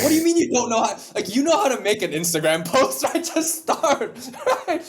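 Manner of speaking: high, loud mock outrage breaking into laughter; exasperated retort. Podcaster answering someone who won't start, laughing mock exasperation. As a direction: laugh through an incredulous telling-off. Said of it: "You probably wanna slap them".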